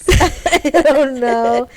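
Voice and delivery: laughing. Real, not acted. Laughing after feelings remark.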